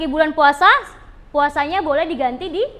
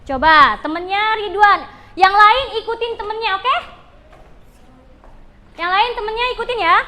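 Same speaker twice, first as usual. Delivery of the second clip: ordinary talk, nothing marked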